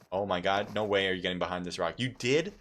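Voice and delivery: usual speaking voice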